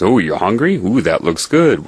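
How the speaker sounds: greeting a guy holding pizza, playful; playful alternative. Retold or imitated. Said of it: "I could say a lot of things".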